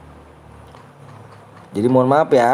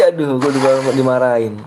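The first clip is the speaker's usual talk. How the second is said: ordinary delivery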